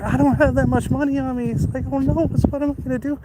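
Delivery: high-pitched mock distress; imitating distressed date. Date to helper about money, high imitated fretting. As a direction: quote her fretting, voice lifted high. Quoting date short of cash.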